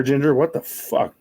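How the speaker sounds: normal talking voice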